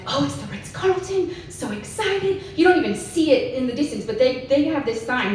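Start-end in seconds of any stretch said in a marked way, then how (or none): 0.1-2.4 excited self. Retold or imitated. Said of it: "You're like".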